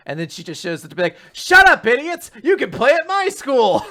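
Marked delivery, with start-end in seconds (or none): 1.3-3.8 loud, high-pitched brash yell; boisterous character entrance, yelled at full tilt; high-energy shout. Acted. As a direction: burst in shouting over everyone. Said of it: "with all of this energy".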